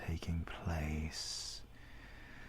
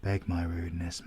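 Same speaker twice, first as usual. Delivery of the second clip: ordinary talk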